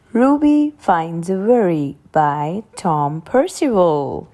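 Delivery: ordinary delivery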